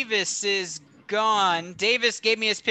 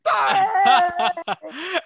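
higher, louder shrieking laugh; loud laughing outburst; 9 semitones higher, 4 dB louder than usual. Real, not acted. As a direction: burst out cracking up at the joke. Reaction to Brady revenge joke.

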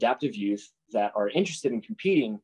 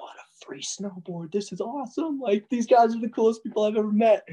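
put-on starstruck teen; 13 semitones higher than usual. Getting a free board from his heroes, excited high gush. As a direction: gush like a thrilled kid.

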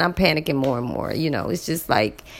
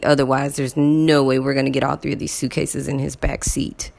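normal talking voice

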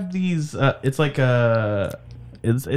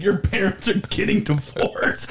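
laughing mock threat; 4 semitones higher than usual. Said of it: "I said".

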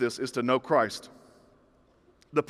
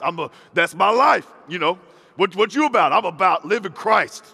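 louder, hammed-up bold declaration; mock confident; 2 semitones higher, 6 dB louder than usual. Churchgoer parroting a phrase to others, mock confident. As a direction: declare it loudly as if totally sure.

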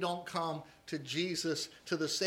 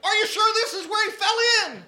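put-on much higher, louder puzzled drunk; bewildered drunk; 13 semitones higher, 13 dB louder than usual. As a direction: ask it in earnest like a confused old drunk. Punchline, drunk asks preacher.

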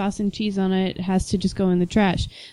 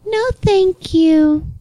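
much higher and very slow, sugary put-on politeness; polite refusal; 10 semitones higher, 5 dB louder, much slower than usual. Drive-thru worker coming back to turn down the pizza, slow high sweet voice. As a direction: drag out a sweet fake-polite no.

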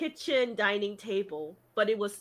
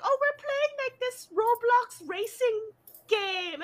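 put-on high child voice, drawn-out; imitated little kid; 11 semitones higher than usual. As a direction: answer like a kid busy with a game. Voices a neighbour kid answering.

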